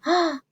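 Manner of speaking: high delighted gasp; mock-amazed gasp; excited gasp. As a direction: gasp for the kids. Reacting to happy story moment.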